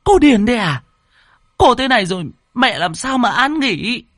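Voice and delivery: high, loud indignant cry; outraged shouting. A narrator's reading. Relative berates Lan for disturbing mother's rest, outraged shouting. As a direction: shout in outrage, stretching the last words.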